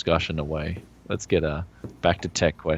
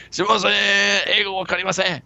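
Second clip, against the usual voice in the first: mock Japanese reply; 12 semitones higher than usual. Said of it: "I'll be like".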